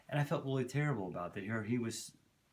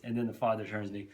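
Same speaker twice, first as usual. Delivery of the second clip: ordinary talk, nothing marked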